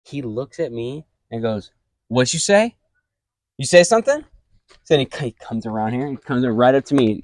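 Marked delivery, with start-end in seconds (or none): 2.1-4.2 high, loud mock challenge; imitating a tough kid, pitched up and louder; imitating a bully. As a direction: sneer it like a kid picking a fight. Bully confronting him in cafeteria.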